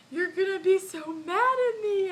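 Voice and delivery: high, loud imitated wail; mock bawling. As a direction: wail it like a bawling kid confessing, hammed-up. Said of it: "And I just started bawling".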